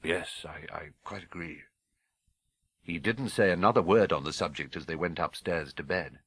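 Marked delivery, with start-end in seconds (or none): 0.0-1.6 much softer, deflated agreement; husband meekly agreeing, quiet and defeated; sinking heart. Acted. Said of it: "his heart sinking horribly".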